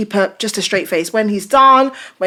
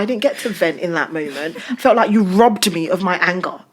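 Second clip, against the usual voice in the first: normal talking voice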